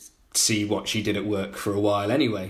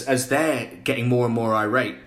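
normal talking voice

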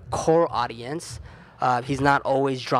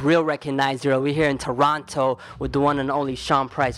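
louder, punchy show-host announcement; radio announcer intro; 3 dB louder than usual. Acted.

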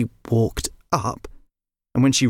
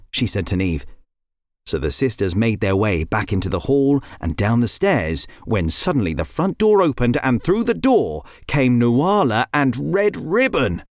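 ordinary delivery, nothing marked

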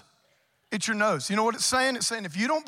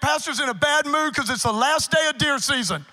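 mock congregation grumble; 5 semitones higher, 6 dB louder than usual. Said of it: "what some of y'all are thinking".

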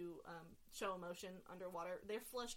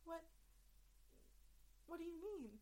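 higher, trembling, fearful plea; a scared Kedian hiding from its captor; frightened Kedian; 9 semitones higher, 6 dB quieter than usual. Acted. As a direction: speak it as if hiding behind a door. Sha'al confused.

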